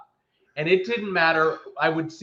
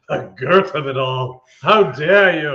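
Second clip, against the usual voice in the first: louder, theatrical indignation; mock outrage; 8 dB louder than usual. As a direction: play the scandalised lady.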